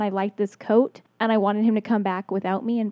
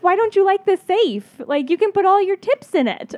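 higher, louder, incredulous questions; baffled boyfriend; 10 semitones higher, 7 dB louder than usual. Retold or imitated. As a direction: act him out as genuinely baffled. Quoting boyfriend's baffled reaction.

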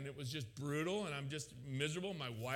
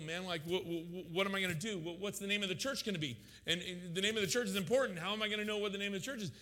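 raised pitch, stumbling, piling up worries; panicked questioning; 5 semitones higher than usual. Retold or imitated. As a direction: fire off anxious objections one after another. Overwhelmed by doubts, rattles off questions.

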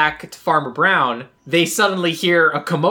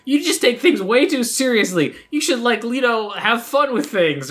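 higher-pitched mock lecture; lightly acted nagging, pitched up; chiding quote; 6 semitones higher than usual. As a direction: tell him off in a raised pitch. Acted lecture to uptight Pete.

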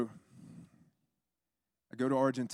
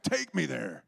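imitated demand; 4 semitones higher, 7 dB louder, slower than usual. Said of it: "he's like".

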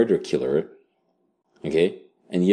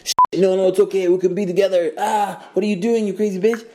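higher, frantic mock pleading; panicked pleading; 11 semitones higher than usual. Player's hero pleading with attacker, high frantic mock panic. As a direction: scramble to calm her in panic. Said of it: "you're like".

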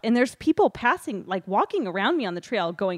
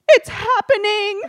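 much higher, louder and slowed, in horror; dread; 13 semitones higher, 10 dB louder, slower than usual. Retold or imitated. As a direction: announce the disaster in a stretched wail. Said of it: "and I said".

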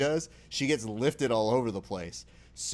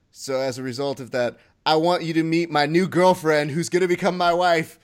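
loud, raised-pitch proclamation with dramatic pauses; mock John Cena speech; 4 semitones higher, 8 dB louder than usual. Host plays Cena introducing his new girlfriend, mock grand speech. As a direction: build up a big public announcement.

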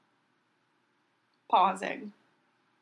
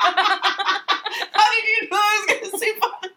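high, loud laughing question; laughing surprise; 13 semitones higher, 8 dB louder than usual. Real, not acted. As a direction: laugh in disbelief.

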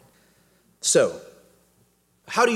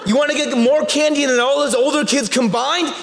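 very high, loud, building excitement; hyped-up dad; 12 semitones higher, 7 dB louder than usual. Retold or imitated. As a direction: egg him on like it's a grand revenge plan. Dad escalating the candy promise.

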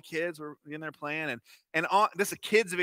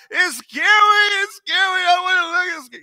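high, loud panicked crying wail; imitating a terrified child; 13 semitones higher, 12 dB louder than usual. Three-year-old screaming in fright at a cartoon, loud high panic. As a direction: wail it like a toddler losing his mind in terror. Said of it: "screaming, crying".

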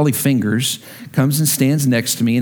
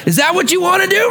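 angry tantrum scream; 11 semitones higher than usual. Pretend. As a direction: throw a fit and scream it at him. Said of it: "I wanted to pitch and scream and throw a fit and go".

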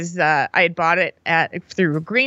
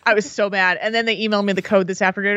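ordinary delivery

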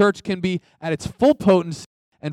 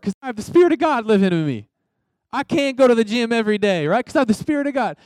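much higher, louder, fast sarcastic whine; mocking excuse; 8 semitones higher, 4 dB louder, faster than usual. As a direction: parody someone dodging the gym with scripture. Mocking lazy excuses using faith.

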